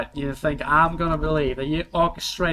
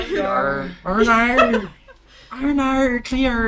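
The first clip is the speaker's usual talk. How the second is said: lightly rolled-R American voice, higher; American pirate accent; 9 semitones higher, 5 dB louder, much slower than usual. Acted. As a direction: growl out yar like a pirate. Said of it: "rolling the R's, I guess, like an American".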